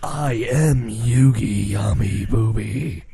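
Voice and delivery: low, slow, grand dub-hero voice; pompous drawn-out mock introduction; Yugi. Friend parodying a dubbed hero, low and slow. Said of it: "Yugi's all like".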